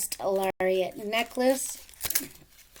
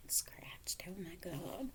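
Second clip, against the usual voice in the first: whisper; 2 semitones lower, 15 dB quieter, slower than usual. Real, not acted. Whispered dismay.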